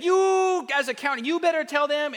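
high, hammed-up official demand; mock-threatening state official; mock bossy order. Acts out state ordering county.

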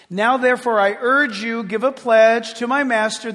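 loud, declamatory Bible reading; scripture reading. Acted. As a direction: read the verses out forcefully to the congregation. Reads scripture aloud to congregation.

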